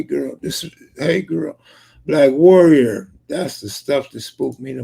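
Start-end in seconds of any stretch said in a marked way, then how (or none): 2.1-3.1 louder and slow, reading out a name; calling out a chat name; loud slow callout. Real, not acted. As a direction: call the name out slowly and loud. Calling out a username loudly.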